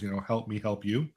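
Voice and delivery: normal talking voice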